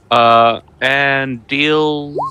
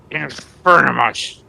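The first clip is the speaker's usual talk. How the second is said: a mildly raised spell cry; shouted incantation; 3 semitones higher, 3 dB louder than usual. Acted. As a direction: call out the spell with restrained force. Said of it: "as he shouts".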